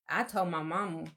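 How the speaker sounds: usual speaking voice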